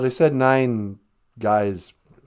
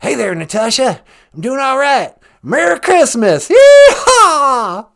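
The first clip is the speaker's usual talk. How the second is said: higher, loud Texan cowboy drawl; George W. Bush impression; 13 semitones higher, 11 dB louder, slower than usual. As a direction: play a folksy ex-president greeting friends. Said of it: "former president of the United States, George W".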